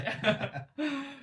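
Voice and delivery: high burst of laughter; laughing. Real, not acted. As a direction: laugh at yourself. Laughing at himself.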